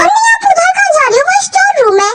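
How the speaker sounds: high, urgent call to stop; alarmed calling out. Acted. Stopping someone entering wrong room.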